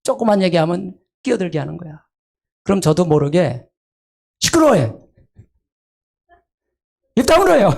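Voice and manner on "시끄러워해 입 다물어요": high, loud irritated snap; snapping shut up. Shown as a demonstration. A counselor silencing an interrupting spouse, snapping. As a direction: cut the interrupter off sharply. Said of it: "이렇게 하면 또 시험 들잖아요".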